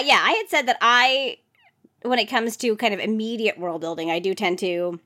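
ordinary delivery

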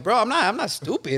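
replaying how fed up he was, a half-mock outburst; exasperated mock protest, voice jumping up; frustrated outburst. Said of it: "I was just getting frustrated".

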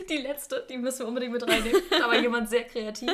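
giggling while teasing what's coming; laughing. Real, not acted. Host teasing listeners about a funny question, laughing.

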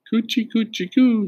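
high cooing Coochie, coochie, coo; baby talk. A narrator's reading. A girl cooing high baby talk over the crib. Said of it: "she said to Tootsie".

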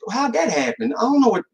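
mock shocked panic. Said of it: "they be acting all shaky".

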